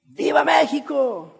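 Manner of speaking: high, drawn-out rallying cry; Independence Day shout. Acted. An official giving the grito to the town, ceremonial shout. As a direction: cry out the Mexican grito, stretched long. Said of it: "Y el grito es".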